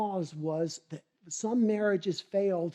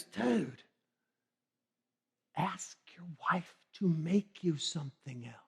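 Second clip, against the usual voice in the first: softer, dropping to a whisper; whispered advice; 7 dB quieter than usual. Acted. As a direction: lean in and whisper the tip. Buddy quietly advises him.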